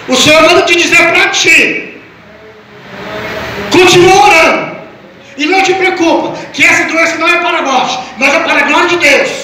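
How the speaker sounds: high, forceful preacher's delivery of a message; prophetic proclamation. Retold or imitated. Friend pastor's message to the praying grandfather, retold as prophetic proclamation. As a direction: proclaim it as a word from the Lord.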